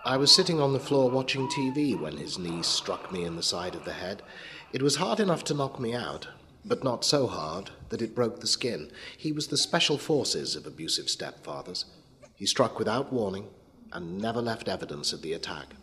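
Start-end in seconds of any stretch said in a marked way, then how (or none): none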